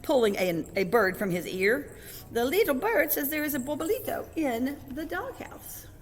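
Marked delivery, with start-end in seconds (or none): 2.3-5.9 high-pitched, singsong announcement; storybook character voice. A narrator's reading. Kitten passes on the little bird's news, high character voice. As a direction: pitch it up high and let it climb at the end.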